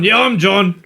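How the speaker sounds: lightly voiced drunk, high and sly; a hint of a drunk lying to get the cab; cheeky drunk. Drunk stranger stealing a taxi, mild mock voice.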